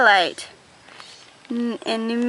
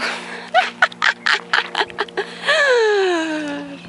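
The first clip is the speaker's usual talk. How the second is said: startled laugh; 8 semitones higher than usual. Real, not acted. Ran into a branch.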